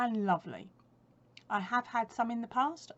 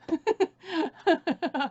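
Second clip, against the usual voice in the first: loud high burst of laughter; real laugh, pitched high and falling; hearty laugh; 8 semitones higher, 9 dB louder than usual. Real, not acted. Joking with viewers about too many treats, laughing.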